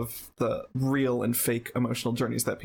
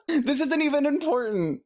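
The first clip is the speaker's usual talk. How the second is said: very high, squealed through laughter; helpless laughter; 4 dB louder than usual. Real, not acted. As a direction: crack up while protesting. Cracks up over the pronunciation quarrel.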